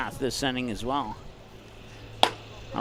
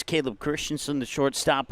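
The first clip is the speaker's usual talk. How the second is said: ordinary delivery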